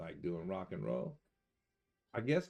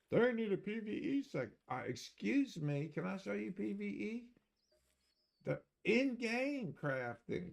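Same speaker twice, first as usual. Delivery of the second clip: high whiny mock of a PVE gamer; hammed-up high whine mocking PVE fans; mocking PVE player; 11 semitones higher than usual. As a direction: squeak it out like a nagging PVE player. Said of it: "you got the other side, right? The P V E players".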